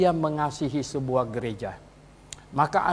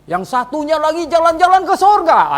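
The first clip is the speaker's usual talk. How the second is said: mocking exclamation; 9 semitones higher, 8 dB louder than usual. Real, not acted. Mocking heaven-trip claim.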